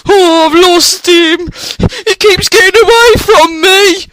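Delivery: high, loud tearful wail. Acted. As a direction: wail it out, slow at first, near tears. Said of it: "he wailed".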